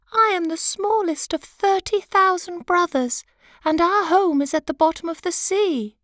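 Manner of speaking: a tiny sea animal piping up boldly to the king; squeaky sea creature. Acted.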